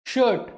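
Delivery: शर्ट said shut, no r at all; silent r shirt. Shown as a demonstration. Teacher tells class शर्ट has silent r. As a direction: drop the r in शर्ट, long vowel. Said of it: "R silent".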